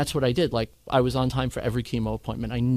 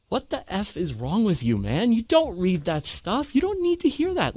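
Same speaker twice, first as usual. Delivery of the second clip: raised, exasperated boss telling him off; boss scolding; 9 semitones higher than usual. Retold or imitated. Boss scolding a panicking employee, mock exasperated. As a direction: scold him like a blunt boss who cares. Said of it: "He goes".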